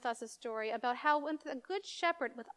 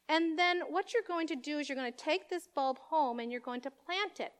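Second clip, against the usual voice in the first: ordinary talk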